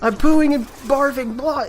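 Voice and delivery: high, strained gagging voice, words broken by barf noises; retching mid-words. Retold or imitated. To his worried girlfriend at the bathroom door, gagging and retching. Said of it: "in between barfing".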